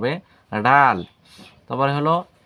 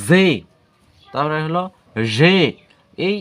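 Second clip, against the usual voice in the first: ordinary talk, nothing marked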